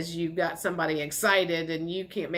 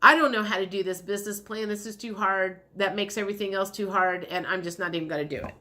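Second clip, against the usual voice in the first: frustrated giving up; faster than usual. Retold or imitated. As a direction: rush through it then let it die. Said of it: "like, going".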